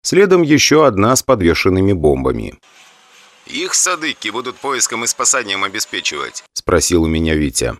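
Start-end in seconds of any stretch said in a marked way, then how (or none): 3.5-6.4 over intercom. Acted. Crew talk on helicopter intercom.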